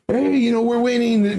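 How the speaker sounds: high excited bragging to a spouse; acted-out happy outburst; really happy. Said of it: "you'll be really happy".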